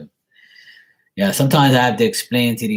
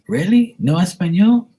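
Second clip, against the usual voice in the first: high, louder incredulous question; surprised saleslady; 10 semitones higher, 5 dB louder than usual. Acted. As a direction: imitate a lady in disbelief, pitched way up. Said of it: "And she goes".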